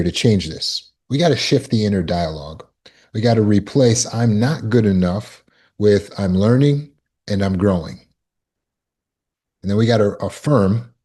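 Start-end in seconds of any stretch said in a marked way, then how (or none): none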